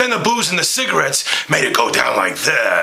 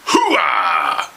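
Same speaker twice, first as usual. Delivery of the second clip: hooah shout. Acted. Pacino catchphrase shout.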